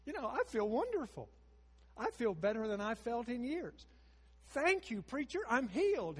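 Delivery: jubilant, thanking the preacher; joyful woman. Retold or imitated. Healed woman thanking Craddock, mock jubilant high voice.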